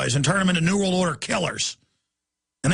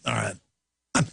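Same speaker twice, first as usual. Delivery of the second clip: usual speaking voice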